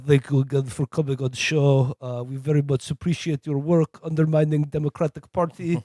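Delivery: mock thick Russian accent; hammed-up Russian handler voice. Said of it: "had a thick Russian accent".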